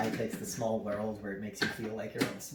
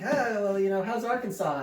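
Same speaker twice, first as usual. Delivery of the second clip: higher-pitched, lightly voiced small-talking dad; chatty dad; 8 semitones higher, 3 dB louder than usual. Dad to the other family from Arkansas, lightly voiced chatty dad. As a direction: imitate a dad making friendly small talk. Dad's small talk with strangers.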